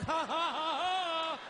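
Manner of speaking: high slow nervous fake laugh; forced laugh. To his panicking son, high fake laugh. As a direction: cover the joke with a strained laugh. Dad covering the doctor's joke.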